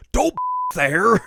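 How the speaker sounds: high, loud cartoon outburst; outraged mock yell. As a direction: yell it in exaggerated horror. Vegeta yells at Goku.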